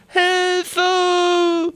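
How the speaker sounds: high, panicked scream; cry for help. A narrator's reading. Boy swept away by the river, screaming for help. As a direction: scream for help as the current takes you. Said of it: "schrie er".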